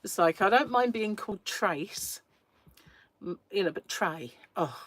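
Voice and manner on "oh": ordinary talk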